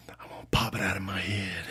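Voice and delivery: soft secretive whisper; whispered confession. Retold or imitated. Woman whispering her fantasy to the guy, hushed. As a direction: lean in and whisper it.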